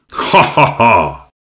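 slow, drawn-out mocking laughter; jeering laugh. A narrator's reading. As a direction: laugh at your own cruel joke. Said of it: "şaka niyetine söylediği için".

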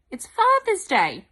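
it's Father's Day, loud and high; excited announcement. Shown as a demonstration. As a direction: announce it like a big surprise. Big announcement to children.

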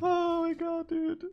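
high, drawn-out surprised cry; high-pitched disbelieving "oh my god"; stunned exclaim. Real, not acted. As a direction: gasp it out in disbelief. Reacts to being blown up.

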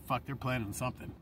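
hushed dawning dread; quiet realization. Retold or imitated. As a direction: say it under your breath. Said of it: "I was like".

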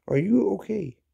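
usual speaking voice

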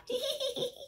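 high silly giggle; demo giggle. Shown as a demonstration. Teacher demonstrates a giggle for children. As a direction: show the kids a giggle. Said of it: "What does it sound like when you giggle?".

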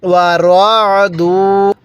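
"ওয়া রাদু" slow, with a rounded ro and long duu; measured recitation. Shown as a demonstration. Teacher showing how letters join, slow recitation. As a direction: stretch both syllables of রাদু. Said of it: "দাল লাগবে ওয়াওয়ের সাথে".

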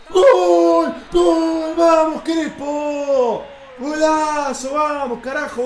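high, loud, drawn-out goal yell; goal celebration shout. Real, not acted. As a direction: scream the goal with stretched vowels. Celebra un gol jugando. (grito de gol eufórico y alargado)

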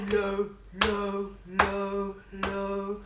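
low fading. Shown as a demonstration. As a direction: sink down low and fade. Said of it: "Can I hear your low voice?".

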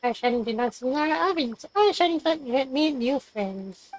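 ordinary delivery

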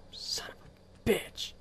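slow hushed whisper of a curse. Real, not acted. Player hiding from monsters, whispering a curse. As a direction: swear under your breath, slowly.

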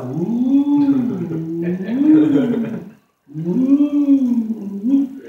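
high mock ooh of a vague testimony. Mocking a vague testimony, a high mock ooh. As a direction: imitate a wishy-washy conversion story. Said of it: "it was kinda like".

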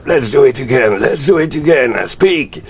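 high, excited put-on English voice; excited English command. Voice on the phone demanding another take, acted high and excited. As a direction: imitate someone urgently calling out in English.

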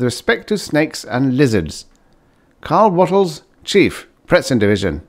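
crisp, formal letter reading; official memo read. A narrator's reading. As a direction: read it like a bureau dispatch.

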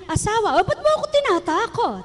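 lightly voiced wife asking husband; imitated wife. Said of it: "pag sinabi niya".

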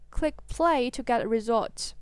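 ordinary delivery

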